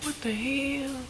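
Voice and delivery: very soft, puzzled mutter; hushed disbelief. Real, not acted. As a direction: murmur it under your breath. Quiet reaction to sudden happening.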